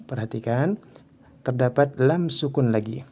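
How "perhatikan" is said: ordinary delivery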